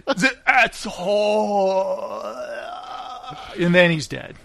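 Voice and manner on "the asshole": very slow, drawn-out mock death groan; hammed-up expiring villain; imitated dying crook. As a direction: stretch the last word into a dying moan. Imitating the dying bad guy.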